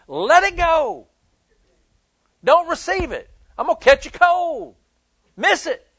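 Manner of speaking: high, loud rallying exhortation; fiery pulpit call; preaching. Acted. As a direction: drive it home to the congregation. Preaching at full force.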